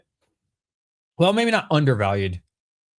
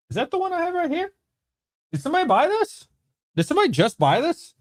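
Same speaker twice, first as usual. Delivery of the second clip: surprised. Real, not acted. Notices an unexpected sale.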